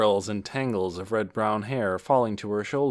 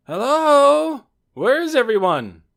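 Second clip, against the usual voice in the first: loud, high call of arrival; man's shout; 10 dB louder, slower than usual. A narrator's reading. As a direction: holler out a greeting to the whole house. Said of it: "a shout in a man's voice".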